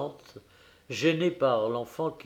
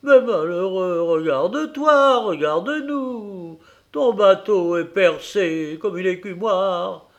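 high, loud, scornful scolding; scolding tirade; 7 semitones higher, 8 dB louder than usual. A narrator's reading. Wife berating her husband about the leaky boat, loud scolding. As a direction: berate him for his folly.

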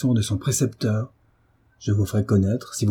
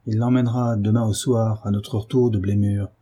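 usual speaking voice

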